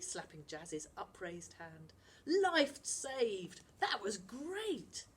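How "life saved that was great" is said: excited. Acted. Celebrating the rescue.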